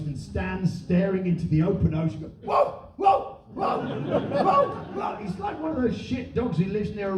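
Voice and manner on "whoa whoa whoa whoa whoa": high, spaced-out barks; mock dog barking. Mocking a man at the coast, barking like an insane dog. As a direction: bark like a mad dog at the sea. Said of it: "basically to bark at the open sea".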